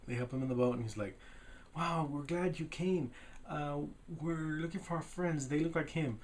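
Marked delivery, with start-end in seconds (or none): 1.8-6.2 higher-pitched, lightly acted welcome; retold gushing welcome; mock delighted greeting. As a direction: play a pleased hello in a raised voice. Retelling a friendly greeting in dialogue.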